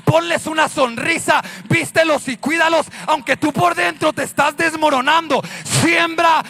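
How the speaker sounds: loud, rising exhortation building to a shout; full-force preaching. Real, not acted. Preacher urges the weary to keep sowing, impassioned shouting. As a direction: drive each phrase harder, up to a shouted climax.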